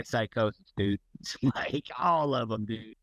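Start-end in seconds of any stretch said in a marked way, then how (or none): none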